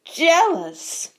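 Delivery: high, loud, incredulous echo; scornful disbelief. A narrator's reading. Bully answers young fan's accusation, scornful. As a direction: throw the word back with scorn.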